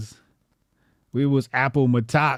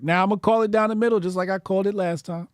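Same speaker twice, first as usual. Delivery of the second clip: fast, higher, declarative; quick referee call; 6 semitones higher, faster than usual. Shown as a demonstration. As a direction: call it like an umpire. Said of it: "repeated line".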